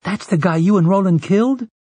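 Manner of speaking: high-pitched, incredulous question; dawning realisation. A narrator's reading. Jake to Eddie as the penny drops, surprised. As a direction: lift the voice as it suddenly clicks. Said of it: "as the penny dropped".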